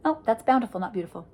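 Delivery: plain reading voice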